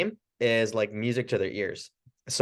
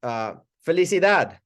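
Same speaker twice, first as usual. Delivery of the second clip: bright, higher greeting to a patient; friendly Spanish greeting; 5 semitones higher than usual. Shown as a demonstration. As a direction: greet them warmly in Spanish. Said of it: "I would say".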